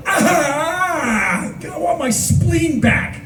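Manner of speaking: high, slow pained cry; anguished whine. Retold or imitated. Teller pleading with his ex, high slow whine. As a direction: moan it like heartbroken.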